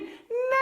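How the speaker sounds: high furious horse neigh; angry neigh. Acted. Angry horse lifting its leg, shrieking neigh.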